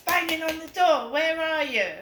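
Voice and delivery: mock impatient shout; agitated. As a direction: call out impatiently through a door. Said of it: "they get agitated".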